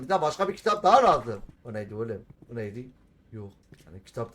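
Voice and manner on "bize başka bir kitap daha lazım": the same line said again, louder and higher; emphatic louder repeat. Shown as a demonstration. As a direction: push it out with more force.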